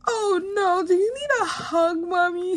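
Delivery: high-pitched little son offering comfort; high, sweetly concerned kid imitation; genuine child concern. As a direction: imitate an earnest small child consoling mommy. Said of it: "he was being genuine".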